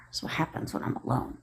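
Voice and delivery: soft unvoiced aside to herself; whispered aside. Real, not acted. Whispering an aside about rambling alone.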